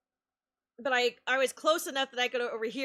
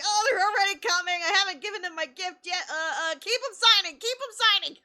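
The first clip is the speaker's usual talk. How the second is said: panicked; 7 semitones higher, 5 dB louder than usual. Retold or imitated. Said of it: "I panicked".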